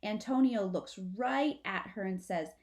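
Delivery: ordinary delivery, nothing marked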